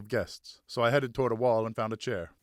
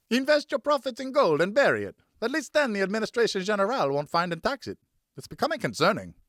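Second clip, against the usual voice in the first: a hint of a guest's voice; 11 semitones higher, 5 dB louder than usual. Another guest answers.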